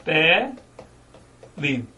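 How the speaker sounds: "Pele" broken into two syllables, long pause between; split into syllables. Shown as a demonstration. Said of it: "Separar as sílabas".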